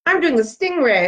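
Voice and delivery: proudly name a made-up dance move; silly announcing. Acted. Girl calling out her silly dance move to her friend, goofy.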